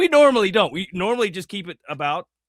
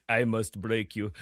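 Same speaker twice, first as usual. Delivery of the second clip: put-on low, slow Russian accent; Ivan Drago; 6 semitones lower, 6 dB quieter than usual. Signing off the podcast as a Russian movie fighter, low Russian accent. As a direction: do a mock Russian tough guy, slow and softer. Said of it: "that's my best Ivan Drago".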